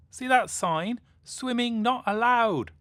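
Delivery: high, loud shouted warning across the pond; yelling. Acted. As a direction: call out to the swimmer at full voice. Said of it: "yelled Jay".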